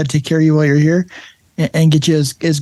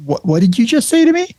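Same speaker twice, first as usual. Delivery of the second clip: shocked; 7 semitones higher than usual. Retold or imitated. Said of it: "Uda just looks at me like".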